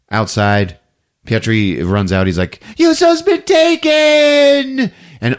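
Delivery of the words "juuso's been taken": high, loud, drawn-out alarm cry; mock panicked yell. Pietri runs outside to tell the men, panicked yell. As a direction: wail the news at full pitch, hammed-up.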